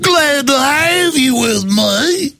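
very high, slow, exaggerated Southern drawl; Hillary Clinton, put-on Kentucky accent. Politician greeting a Kentucky crowd, hammed-up Southern accent. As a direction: stretch every word into a mock Kentucky twang. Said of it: "Or Hillary in Kentucky".

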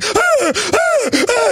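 very high, squealing mock whimpers; mock whimpering cries. Host mocks a victim crawling from Jason, with very high squealing whimpers. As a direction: whine like a helpless victim in a horror film. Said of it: "crawl backwards on your hands and your legs going".

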